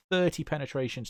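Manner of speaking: normal talking voice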